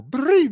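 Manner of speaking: high squeaky tic yelp; tic noise. Retold or imitated. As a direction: blurt out a fake tic. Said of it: "faking the whole".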